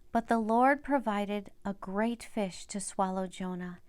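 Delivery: ordinary delivery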